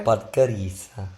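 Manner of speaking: put-on Podgorica with an Italian accent. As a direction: say it like an Italian would. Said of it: "repeating it in an Italian accent".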